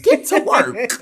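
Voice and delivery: loud, high-pitched teacher's order; commanding voice. Retold or imitated. Sub to staring students, commanding shout. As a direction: re-enact ordering a class back to its task. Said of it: "So you have that commanding voice".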